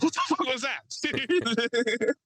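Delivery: high, drawn-out incredulous cry; stunned disbelief. Retold or imitated. Bellman reacting to the manly voice, mock stunned squeal. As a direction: squeal it slowly in hammed-up shock.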